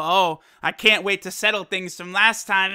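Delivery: ordinary talk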